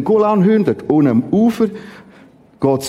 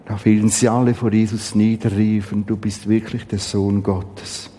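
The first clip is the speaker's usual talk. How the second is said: ruhig und tiefer vorgelesen; Bibellesung; 9 semitones lower than usual. Acted. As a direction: lies den Text gesammelt und gemessen vor. Liest Matthäus vor, leiser. (feierliche, leise Schriftlesung)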